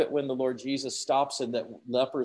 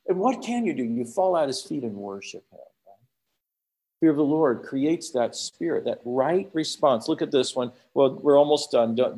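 ordinary talk

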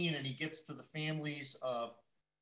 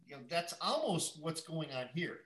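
ordinary talk

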